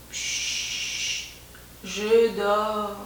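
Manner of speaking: sleepy hushed whisper; whispering over a crib. Acted. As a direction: hush it like someone half asleep. Sleeping thumb answers.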